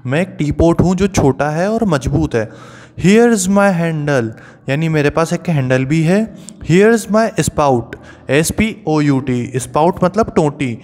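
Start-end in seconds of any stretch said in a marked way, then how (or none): 3.0-4.3 slow, sing-song rhyme reading; recited rhyme line. Shown as a demonstration. Teacher reading the teapot poem to students, slow sing-song. 6.7-7.8 slow, sing-song rhyme reading; recited rhyme line. Shown as a demonstration. Teacher reading the teapot poem to students, slow sing-song.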